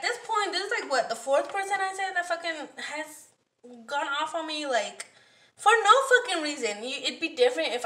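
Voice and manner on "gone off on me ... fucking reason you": ordinary talk, nothing marked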